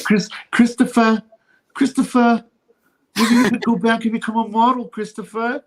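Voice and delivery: high, loud mimicked nagging; Sopranos character impression. Acting out a Sopranos character nagging Christopher.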